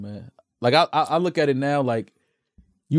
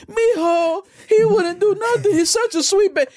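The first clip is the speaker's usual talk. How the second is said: high, tearful grandma pleading for her grandson; crying grandma; 14 semitones higher, slower than usual. Retold or imitated. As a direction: sob it out as the doting grandma. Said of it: "the sweetest grandma".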